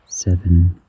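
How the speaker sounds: normal talking voice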